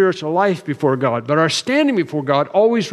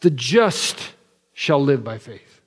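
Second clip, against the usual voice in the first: "just" drawn out, then a pause before the rest; stress on JUST; slower than usual. Shown as a demonstration. Preacher showing his congregation the Galatians emphasis, stress on "just". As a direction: lean slowly on the word "just". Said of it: "the emphasis is upon the just".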